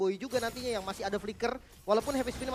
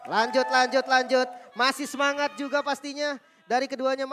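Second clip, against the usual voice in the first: much louder, eager shouting; loud hype; 2 semitones higher, 8 dB louder than usual. Real, not acted. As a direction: cheer the game on. Co-caster cheers to continue.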